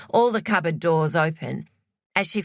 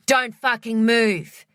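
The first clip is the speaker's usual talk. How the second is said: higher, louder, slow threat; a raised, slow furious order; 6 semitones higher, 4 dB louder, slower than usual. Acted. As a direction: warn him off hard, weapon raised. Troy threatens intruder with weapon.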